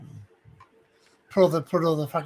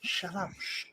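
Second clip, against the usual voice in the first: retold hushing; 12 dB quieter, slower than usual. Imitating a store detective who has been recognised, hushing softly. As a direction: hush them so your cover isn't blown.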